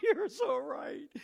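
high, loud, drawn-out mock wail; overwhelmed agreement. Friend answering the song in his car, hammed-up tearful amazement. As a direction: cry it out like an amazed convert.

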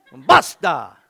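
loud, punchy "Basta"; forceful demo. Shown as a demonstration. Preacher demonstrating the word to the congregation, forceful shout. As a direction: hit "Basta" hard and loud. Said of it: "you gotta do it like this".

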